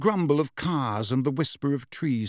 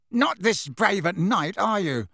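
sneering jibe, pitch raised; 6 semitones higher than usual. A narrator's reading. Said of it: "sneered Dudley".

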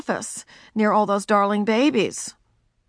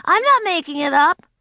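put-on insistent child; 10 semitones higher, 4 dB louder than usual. Ramona pleading.

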